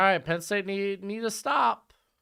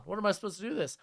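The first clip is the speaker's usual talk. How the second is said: ordinary talk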